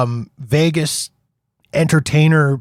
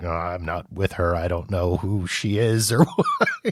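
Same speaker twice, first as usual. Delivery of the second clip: sheepish denial; 7 semitones lower, 7 dB quieter than usual. Retold or imitated. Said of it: "the dude was just kinda like".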